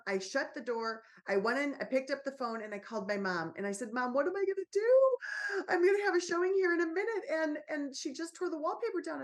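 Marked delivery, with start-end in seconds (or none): 3.9-9.2 high, loud, rushed panicked call to mom; panicked plea. Retold or imitated. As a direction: blurt it out in a rush, near tears with panic.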